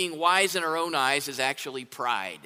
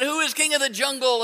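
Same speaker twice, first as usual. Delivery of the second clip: higher lion's boast; boastful lion; 7 semitones higher than usual. A narrator's reading. Lion asking the monkeys who is king, boastful call. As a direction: crow it like a vain king. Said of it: "and said".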